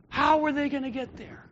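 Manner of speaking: normal talking voice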